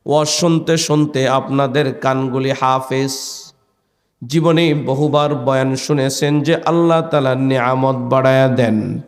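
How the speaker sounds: usual speaking voice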